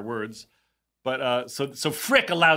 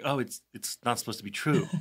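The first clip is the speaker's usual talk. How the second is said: meek retort; 4 semitones lower, 6 dB quieter than usual. Retold or imitated. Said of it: "He's like".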